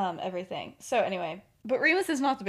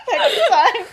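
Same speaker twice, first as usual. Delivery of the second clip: very high, loud, laughing finish; mock-offended comeback; 13 semitones higher, 13 dB louder than usual. James ending his staged insult, loud mock offence through laughter. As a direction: shout the last jab while cracking up.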